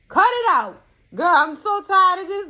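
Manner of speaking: fed-up loud outburst; exasperated outburst. Real, not acted. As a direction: snap at him, done with it.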